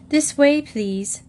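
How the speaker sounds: polite usher. A narrator's reading. Desk girl directs the party.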